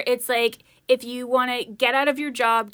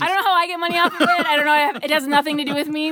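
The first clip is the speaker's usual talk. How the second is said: higher, louder and fast; defensive laughing denial; 4 semitones higher, 3 dB louder, faster than usual. Real, not acted. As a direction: protest your innocence fast.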